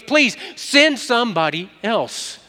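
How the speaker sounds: high, loud, slow begging; desperate high pleading, drawn out; emphatic plea. Acted. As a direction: beg to get out of it, stretching each word. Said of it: "how emphatic he was of not going".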